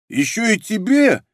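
high, drawn-out indignant question. A narrator's reading. Rude client balking at the middleman's fee, indignant. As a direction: ask in rising outrage, stretching the words. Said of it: "возмутился Хамоватый".

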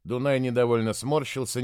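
ordinary delivery, nothing marked

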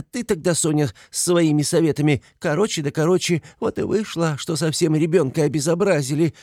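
high-pitched, fretful scolding; agitated mother. A narrator's reading. Frantic mother scolds children in story. (взволнованная мать отчитывает)

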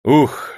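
loud sigh of relief; noisy relieved exhale. A narrator's reading. Steward exhaling with relief after the deal, noisy sigh. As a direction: let the breath out hard. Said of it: "шумно выдохнул Селезень".

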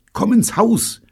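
high, loud, anxious cry; urgent, frightened high-pitched warning; fearful call. A narrator's reading. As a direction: call out in alarm. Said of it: "rief Evelyn angstvoll".

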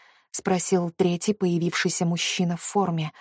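plain reading voice

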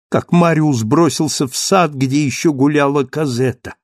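usual speaking voice

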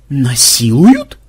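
slow, drawn-out astonishment; amazed question. A narrator's reading. Madam grabbed in a wrestling hold, amazed. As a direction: stretch it into an incredulous question. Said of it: "изумилась мадам".

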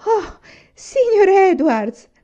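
high, loud, drawn-out cry of surprise; big storybook exclamation; astonished exclamation. A narrator's reading. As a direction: exclaim slowly at an unexpected guest. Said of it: "esclamò".

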